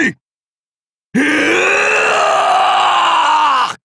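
drawn-out high war cry; long battle roar. Acted. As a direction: roar at full force, holding it long. (長く伸ばす雄叫び)

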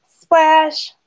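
high splash sound word; exclaimed splash. A narrator's reading. Narrator as Thistle jumps in a puddle, exclaimed.

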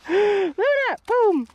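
high, excited call to the dog; playful excitement. Real, not acted. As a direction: shout it playfully as you throw snow. Playing with the dog in snow.

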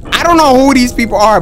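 ordinary delivery

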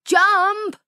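very high, loud shout of a name. Acted. Zap and Whip calling to Chump, high loud shout. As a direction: yell for your friend. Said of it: "they shouted".

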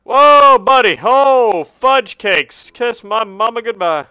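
loud, high-pitched alarmed outburst; startled yell. Real, not acted. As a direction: holler in comic shock. Startled by danger in game.

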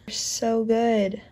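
normal talking voice

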